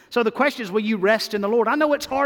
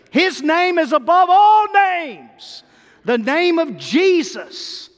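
rising, ringing preacher's cry; louder and higher on each name line; preaching at full force; 5 semitones higher, 3 dB louder than usual. Acted. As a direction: build the praise to a peak. Preaching builds to climax.

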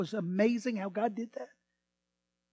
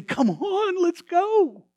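eager invitation; 9 semitones higher, 6 dB louder, much slower than usual. Acted. What Peter might have said.

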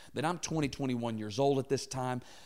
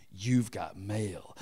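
slow, lightly voiced movie hero quip; a hint of a nineties action hero; action hero one-liner; 4 semitones lower, much slower than usual. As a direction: deliver the pun slowly like a hero at the end of the film. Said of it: "he'd be like".

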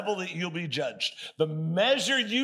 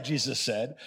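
ordinary talk, nothing marked

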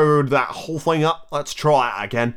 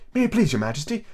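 higher-pitched deferential address; very humble; 7 semitones higher than usual. A narrator's reading. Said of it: "in a very humble tone".

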